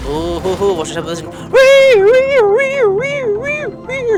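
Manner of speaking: normal talking voice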